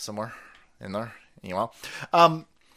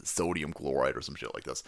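low, mumbling, fading mock excuse; mock officials; 12 semitones lower, 4 dB quieter than usual. Mocking officials explaining cloud seeding, low and mumbling. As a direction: bumble through it like an evasive official. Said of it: "they go".

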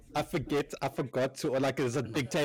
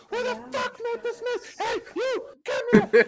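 very high mock bellow; hammed-up strained fury; raging cook; 5 dB louder than usual. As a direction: imitate a kitchen boss on a rampage. Imitating cook raging at mess.

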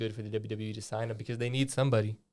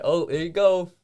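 loud, high mock guard voice; mock prison guards; 7 semitones higher, 9 dB louder, slower than usual. Guard pretending to watch an inmate, loud mock innocence. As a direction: play a careless guard, raised and higher. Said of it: "this is them watching it".

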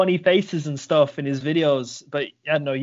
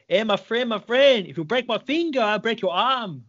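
higher-pitched Brazilian-accent mock threat; 5 semitones higher than usual. Grappler jokingly warning a finger-bending partner, in a Brazilian accent. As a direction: warn like a Brazilian grappler, pitched up, drawing out the ending. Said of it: "Brazilian about it".

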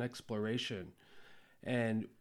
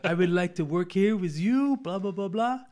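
hammed-up fake French accent, higher and louder as it goes; 7 semitones higher, 9 dB louder than usual. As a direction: play a cocky American faking a French accent to get hired. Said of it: "I put on this fake French accent like this".

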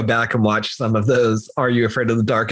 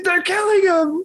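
mock crying actress. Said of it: "like, she's".

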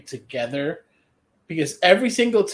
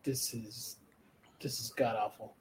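much softer, lower muttered disgust; quiet disgusted aside; 1 muttered verdict; 5 semitones lower, 13 dB quieter than usual. Retold or imitated. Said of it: "and I was like".

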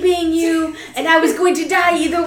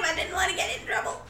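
normal talking voice

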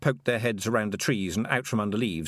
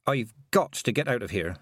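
ordinary delivery, nothing marked

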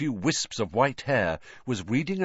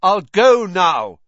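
raised voice, loud and high; half shout; 8 semitones higher, 11 dB louder than usual. A narrator's reading. Harry speaks to a wizard who might be deaf, half shouting. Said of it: "he half shouted".

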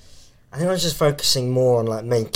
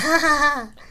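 mock laugh. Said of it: "they're like".

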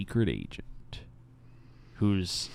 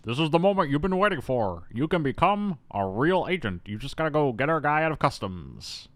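lightly voiced movie boss, pitched up; a hint of hammed-up boss, higher voice; mock boss; 5 semitones higher than usual. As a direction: play a boss giving a big pep talk. Acts out boss promoting the hero.

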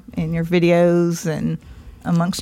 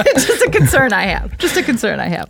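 laughing; 7 semitones higher than usual. Real, not acted. Laughing at her own joke.

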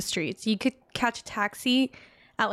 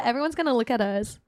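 usual speaking voice

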